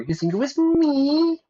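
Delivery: very high, loud mock indignation; squealed disbelief. Accused politician answers rumor, squealed disbelief. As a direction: shoot up into a squeal on me.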